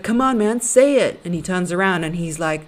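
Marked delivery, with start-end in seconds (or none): 0.0-1.2 loud, high egging-on shout; crowd urging. Retold or imitated. Talk show audience loudly urging the husband on. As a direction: push him to say it, loud and high. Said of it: "And everyone's like".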